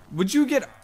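sharp, high snapping demand; impatient demand. Real, not acted. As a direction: bark the order, fed up. Demand before asking nicely.

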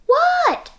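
high loud What?; 1 answering call. A narrator's reading. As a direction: shout it right back.

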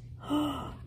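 high, soft intake of breath; amazed gasp. A narrator's reading. Narrator reveals dog atop a treasure chest, amazed gasp. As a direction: gasp in wonder.

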